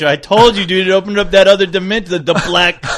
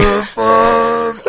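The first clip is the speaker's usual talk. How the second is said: slow, drawn-out robotic announcement; dramatic robot voice; 6 semitones higher, much slower than usual. Shown as a demonstration. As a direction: stretch it out like a machine. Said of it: "we won't sing".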